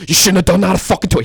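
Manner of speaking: loud, high, agitated scolding; imitated freaking-out dad. Dad scolds teller while freaking out, agitated imitation. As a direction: mimic a father in a flap. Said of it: "He's freaking out".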